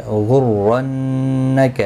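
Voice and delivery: slow chanted غرنک with heavy ر and nasal نّ; tajweed demo. Shown as a demonstration. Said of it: "را زبر کی وجہ سے پر پڑا جائے گا".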